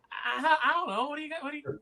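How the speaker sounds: high-pitched, laughing mock defensiveness; defensive. Voicing a flustered actress to co-hosts, defensive. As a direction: pitch it way up, playing put-upon.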